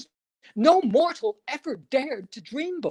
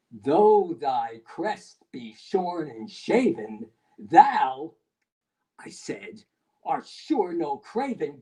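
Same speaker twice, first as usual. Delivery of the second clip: grave address; 8 semitones lower than usual. A narrator's reading. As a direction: speak down gravely to the raven. Addressing the raven.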